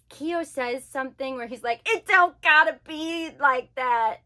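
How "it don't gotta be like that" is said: loud, slow hillbilly imitation; mock hillbilly. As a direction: exaggerate a hillbilly way of talking. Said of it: "hillbilly English".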